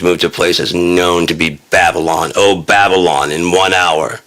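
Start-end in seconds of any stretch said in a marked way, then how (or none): none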